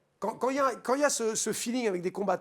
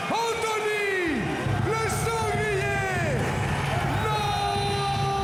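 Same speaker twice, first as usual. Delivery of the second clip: high, slow, drawn-out shouted fighter intro; ring announcer; 7 semitones higher, slower than usual. Shown as a demonstration. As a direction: call the fighter into the cage, stretching every name. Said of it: "On a envie de voir ce que ça donne en vrai".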